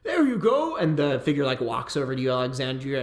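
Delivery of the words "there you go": a much higher, grandly boastful lord; pompous mage. Acted. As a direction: boom out like a vain wizard. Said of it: "He says".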